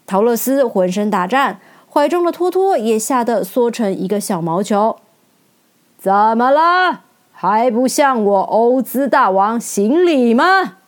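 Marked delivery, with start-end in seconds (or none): 6.0-10.8 拖长声音、缓慢有力地质问; 可怕大王责令来人行礼; 威严的大王. A narrator's reading. (威严地慢声责问)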